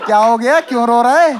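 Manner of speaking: loud, insistent what's-wrong question. Acted. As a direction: call out to a crying mate. Calling to crying friend, demanding. (ज़ोर से टोककर पूछना)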